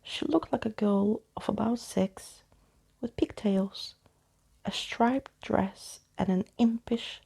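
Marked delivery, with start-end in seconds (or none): none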